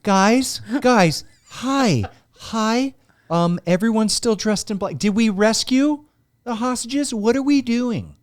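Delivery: high, hesitant mock questioning; Jason Bateman. Actor comes out to ask what happened, high puzzled voice.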